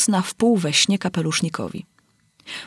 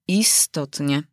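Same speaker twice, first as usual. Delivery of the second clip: sighing; 2 semitones lower, much slower than usual. A narrator's reading. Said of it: "odrzekł z westchnieniem kapelusznik".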